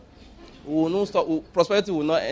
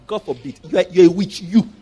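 ordinary talk

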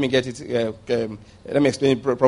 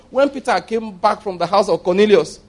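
ordinary talk, nothing marked

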